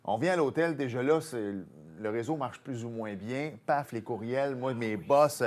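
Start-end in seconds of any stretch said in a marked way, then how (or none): none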